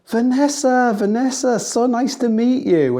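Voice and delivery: high, loud, gushing hello; effusive greeting. Retold or imitated. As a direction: greet them like a long-lost friend, voice way up. Acting out Bear's effusive greeting.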